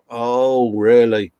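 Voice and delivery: usual speaking voice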